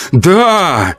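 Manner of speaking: high excited yell; urging the neighbour inside. A narrator's reading. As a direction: shout it after her. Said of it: "заорал я".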